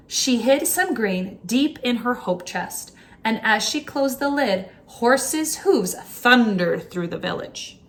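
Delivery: ordinary delivery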